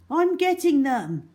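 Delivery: put-on boy calling across the swamp; called out. A narrator's reading. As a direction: shout it back reassuringly. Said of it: "called Algy".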